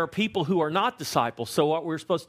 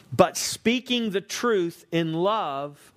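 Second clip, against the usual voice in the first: slow emphasis; much slower than usual. Acted. Slow emphatic scripture reading.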